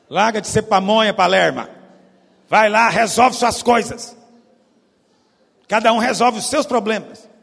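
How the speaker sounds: hammed-up mom telling him off; loud mock scolding, impatient; scolding mother. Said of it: "E ela falou".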